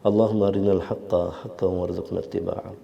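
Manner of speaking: slow, steady recited supplication; prayer. Real, not acted. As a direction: recite the dua with devotion. Guest leads closing dua.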